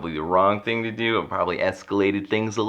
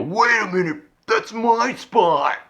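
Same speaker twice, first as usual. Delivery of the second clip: high, slow, whiny entitled voice; mocking how entitled drivers sound, high and drawn out; whiny claim; 4 dB louder, slower than usual. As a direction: stretch it out like a petty brat. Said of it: "and I go".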